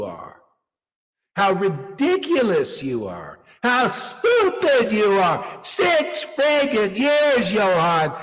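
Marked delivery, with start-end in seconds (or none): 3.6-8.1 higher, louder, slow furious yell at a student; angry shout. Real, not acted. Guru scolding his student Johan, furious slow yell. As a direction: blast him with contempt, slowly.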